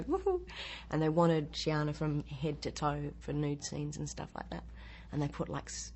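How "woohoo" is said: high gleeful woohoo; excited whoop. Retold or imitated. Actress heading to set, whooping with glee. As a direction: cheer like the last day of school. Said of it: "And you go".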